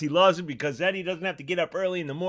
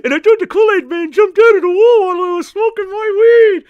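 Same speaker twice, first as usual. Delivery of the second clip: higher, louder goofy puppet character voice; a cheeky stoner puppet griping about the dog; Mister Rob puppet voice; 10 semitones higher, 9 dB louder than usual. Acted. Mister Rob the puppet complains to the host about the barking dog, in a higher, goofy puppet voice.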